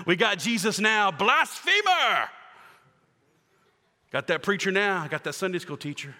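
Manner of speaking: loud, high, triumphant jeering; gloating critics. Acted. Critics jeering at Jesus, loud high gloating. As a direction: crow over finally catching him.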